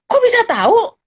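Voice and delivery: can't believe it; amazed. Retold or imitated. Amazed question to the guard. (heran bertanya pada satpam)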